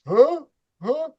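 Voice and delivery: high puzzled "Huh? Huh?" in a mock neighbor voice; imitating neighbor. Neighbor baffled at the screen door, high mock huh. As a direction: play the confused guy next door, pitched high.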